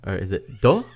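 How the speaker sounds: "deux" offered as a question with a rising end; unsure French guess. Shown as a demonstration. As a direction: ask whether "deux" is said this way, voice rising. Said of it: "I don't know how to read it".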